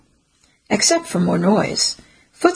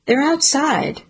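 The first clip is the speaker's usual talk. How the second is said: housekeeper voice; 7 semitones higher, slower than usual. Acted. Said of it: "she says".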